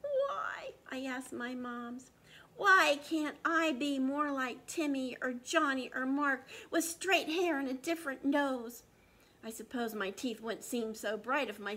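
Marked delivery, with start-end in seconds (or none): 0.0-0.7 sad why. Acted. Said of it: "I cried". 2.6-8.8 louder, lightly voiced upset boy; pleading boy. Boy pleading to his moms.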